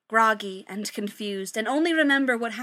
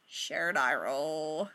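slow, lower, flat-out deadpan; deadpan eye roll; 7 semitones lower, 6 dB quieter, much slower than usual. Acted. Friends mocking Gabe with a slow deadpan eye roll. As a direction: drag it out, utterly unimpressed. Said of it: "the others are like".

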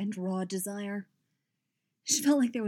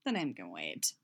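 much lower, quiet; 12 semitones lower, 4 dB quieter than usual. A narrator's reading. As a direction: drop the voice deep. Lower voiced figure.

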